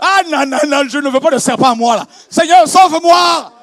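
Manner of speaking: loud and high, rising to a held repeated shout; building preaching cry. Acted. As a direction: climb to a peak, hammering each word.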